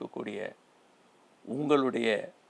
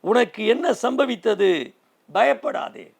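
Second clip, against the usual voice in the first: angel calling; 7 semitones higher, 8 dB louder than usual. Acted. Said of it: "கூப்பிடுகிறார்".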